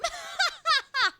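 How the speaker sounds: high loud cackle; burst of laughter. Real, not acted. Yelled at by the game, shrieking laugh. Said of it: "I got yelled at by the game".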